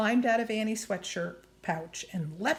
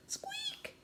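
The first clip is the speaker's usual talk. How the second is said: tiny whispered squeak, very high; mouse squeak; 10 dB quieter than usual. Acted.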